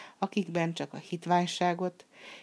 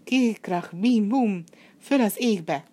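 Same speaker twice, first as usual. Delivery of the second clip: loud, higher magic words, triumphant; shouted spell; 5 semitones higher, 7 dB louder, slower than usual. Acted. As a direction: yell the spell to launch the saddle. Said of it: "kiáltotta".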